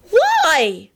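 high, drawn-out why; plaintive high-pitched wail; wailed question. Acted. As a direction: demand it like a hurt girl. Upset Elizabeth demands a reason.

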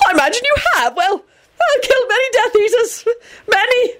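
very high, nervous prattle; terrified babble. A narrator's reading. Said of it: "he babbled".

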